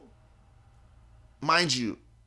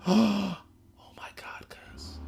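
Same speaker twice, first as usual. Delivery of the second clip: barely audible, stunned whisper after a gasp; gasp, then whisper it under your breath; 8 semitones higher, 3 dB quieter, slower than usual. Retold or imitated. Friend to teller during the car search, gasped whisper.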